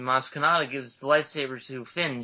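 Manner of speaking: ordinary talk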